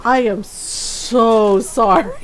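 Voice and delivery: "so" stretched out long; drawn-out, mock-mortified "so sorry"; mortified apology. Telemarketer apologising to the woman he misjudged, mortified drawn-out apology.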